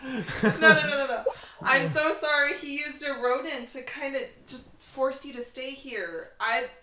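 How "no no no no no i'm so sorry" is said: flustered, laughing through the words; laughing apology. Real, not acted. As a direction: laugh it off, embarrassed. Player laughing at own mix-up.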